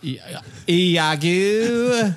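slow, high, grand stage voice; hammy Shakespearean actor. Pretend. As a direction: drag out Iago like an old stage actor.

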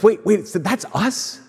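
slow, hushed disbelief; stunned realization. Retold or imitated. The weeping people realize the story is theirs, hushed and slow. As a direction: wonder dawning, half-whispered. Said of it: "they're like".

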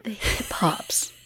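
whispered, slow repeat; soft, incredulous whisper; hushed echo. Real, not acted. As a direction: echo it under your breath, slowly. Echoes co-host's silly phrase.

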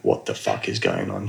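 lightly voiced confused friend; low puzzled friend. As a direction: drop the voice, baffled mate.

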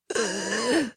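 sharp intake of breath in disbelief; shocked gasp. Retold or imitated. Class hearing the professor's verdict, a shocked gasp. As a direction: gasp as if the words just hit you.

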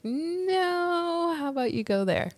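high, soft, drawn-out "no"; coyly redirect, stretching the first word; mock-sweet refusal, softly imitated; playful refusal. Mimics partner redirecting her.

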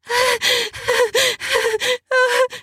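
long high moan of pleasure. Acted. Woman climbing toward orgasm, long high moan.

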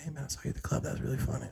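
hushed aside in passing; discreet compliment. Retold or imitated. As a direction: imitate a coworker quietly passing on praise. Imitating coworkers' discreet compliments.

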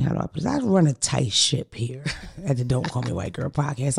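ordinary talk

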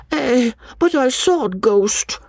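put-on high, slow, frightened boy in tears; tearful boy. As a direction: plead through tears like a scared child. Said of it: "with a stare of terror and a burst of tears".

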